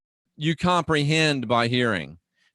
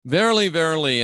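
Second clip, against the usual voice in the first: both VERILYs punched higher and louder; double emphatic; 5 semitones higher, 4 dB louder, faster than usual. Acted. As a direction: read "Verily, verily" with solemn, weighty emphasis on each word. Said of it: "this is what's called a double emphatic".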